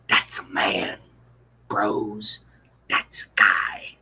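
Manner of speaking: conspiratorial whisper. Real, not acted. Telling the bros the secret, whispered.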